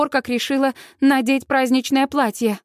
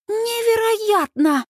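high, drawn-out amazement; thrilled, high-pitched gasp of wonder; delighted awe; 10 semitones higher, much slower than usual. A narrator's reading. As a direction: marvel in a high, stretched voice. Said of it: "в восторге выдыхает Сьюз".

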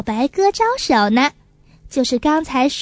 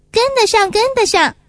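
put-on higher, louder child's eager reply; eager insistence; 7 semitones higher, 3 dB louder, faster than usual. Little pigeon promising magpie sister, eager higher louder child voice. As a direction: jump in, yes I can.

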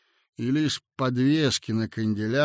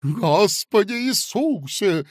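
scared exclamation; 8 semitones higher, 5 dB louder, slower than usual. Acted. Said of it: "сказал толстяк".

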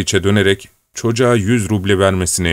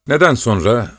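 plain reading voice

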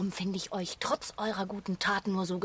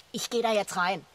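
usual speaking voice

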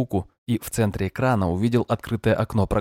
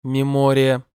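flat synthetic voice, higher and louder; monotone machine voice; 5 semitones higher, 5 dB louder, slower than usual. Acted. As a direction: say it evenly like a program. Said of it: "однотонно произнёс голос".

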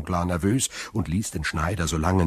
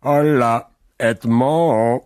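put-on loud, high drunken call across the yard; drunken hail; 7 semitones higher, 8 dB louder, slower than usual. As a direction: bellow a tipsy greeting. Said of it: "rief der betrunkene Caderousse".

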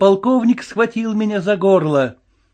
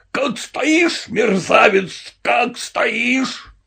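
high, strained, stretched-out scolding; furious roar; 7 semitones higher, much slower than usual. A narrator's reading. Colonel dressing down the Cossack, roaring. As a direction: berate a subordinate in fury. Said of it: "взревел полковник".

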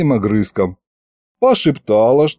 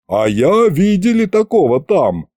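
booming; 6 semitones higher than usual. Acted. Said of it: "прогудела средняя голова".

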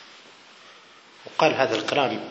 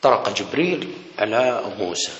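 ordinary talk, nothing marked

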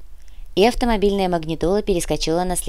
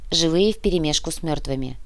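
ordinary delivery, nothing marked

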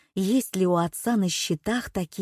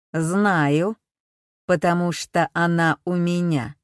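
metallic robot voice; 4 dB louder than usual. A narrator's reading. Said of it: "механический, какой-то металлический голос".

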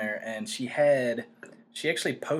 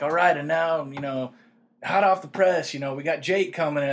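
talk show host intro; 4 semitones higher, 7 dB louder than usual. Retold or imitated. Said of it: "I wish it could just be like".

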